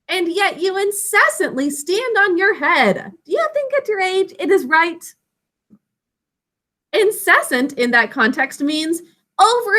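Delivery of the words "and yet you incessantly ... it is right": higher-pitched, singsong recital of verse; poem recitation. Shown as a demonstration. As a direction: lead the class in reciting a line of poetry. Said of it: "say that part with me".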